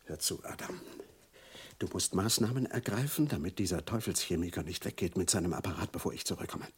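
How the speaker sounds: secretive low whisper on the phone; urgent whisper. Acted. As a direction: whisper so nobody overhears. Secret phone call to Adam.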